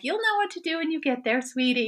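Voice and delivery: high, fast, sing-song imitation of a sweet friend; mocking the friend. Mocking friend's sweet phone advice, high sing-song. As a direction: parody the soothing advice with a lilting high voice. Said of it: "she's telling me".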